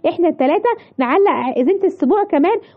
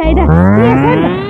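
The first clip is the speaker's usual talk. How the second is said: stern scolding; 5 dB louder than usual. Acted. Mother catches son scaring baby.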